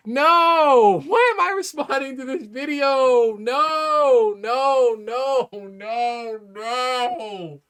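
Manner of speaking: high, drawn-out moaning dismay; hammed-up despairing lament; dismayed wail. Real, not acted. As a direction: wail it slowly, as if all is lost. Dismayed at the commenter's ignorance.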